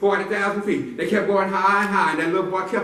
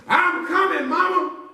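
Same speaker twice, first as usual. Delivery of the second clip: high, eager call to mama; brave little boy; 12 semitones higher, 4 dB louder, slower than usual. Acted. Boy shouting back to his mama. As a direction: call out like a heroic kid. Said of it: "that little boy said".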